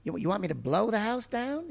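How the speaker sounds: high incredulous pig, rising at the end; disbelieving wisecrack pig; Rodney Dangerfield pig voice. Retold or imitated. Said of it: "who I modelled on Rodney Dangerfield".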